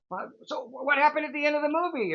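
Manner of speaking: high, rushed mock-irritated question; hammed-up grumpy dad, high and fast; imitating grumbling father. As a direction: pester mom about the ending, still sore. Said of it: "he's still grumbling".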